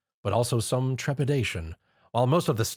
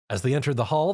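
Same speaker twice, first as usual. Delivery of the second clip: plain reading voice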